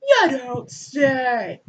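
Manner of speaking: very slow deadpan sarcasm; hammed-up dry reply; mock sarcasm. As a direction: drag it out knowingly. God's sarcastic verdict.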